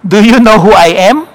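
loud retold comeback, rising at the end; asking back boldly. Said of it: "he asked back".

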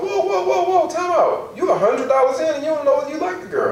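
high, startled whoa easing down as he lectures; alarmed time out. Retold or imitated. Teacher stopping kids planning an expensive first date, startled high whoa. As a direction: pull the kids up short in mock alarm.

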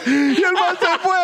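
high, slow, squeezed out through laughing; helpless laughter. Real, not acted. Storyteller delivering his punchline, real helpless laughter. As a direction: struggle to get the words out while cracking up.

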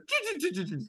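high, soft engine sputter; sputtering engine noise. Retold or imitated. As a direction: imitate a dying engine.

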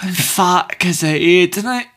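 put-on slow, drawn-out Scottish accent. Quoting Fat Bastard, slow Scottish accent. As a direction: stretch it in a mock Scottish brogue. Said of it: "my stereotypical Scotsman".

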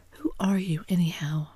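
usual speaking voice

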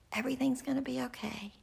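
put-on a mother whispering comfort to her girls; reassuring whisper.

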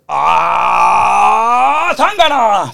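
high outraged cry; mock outraged yell. Outraged reaction to being called nene.

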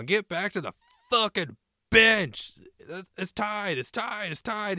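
high, loud, slow yell from the bench; retold screaming. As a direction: scream it at the wandering goalie. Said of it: "is just screaming".

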